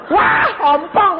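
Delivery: high, loud, slow alarmed cry. Retold or imitated. Dentist fearing his teeth will break, loud alarmed cry. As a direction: cry out in fear for your teeth. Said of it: "aku bilang".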